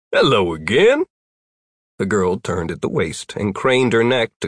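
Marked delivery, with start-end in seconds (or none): 0.1-1.1 a touch louder, cheerful greeting; bright, friendly. A narrator's reading. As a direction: force a cheery hello. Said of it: "in a bright, friendly voice said".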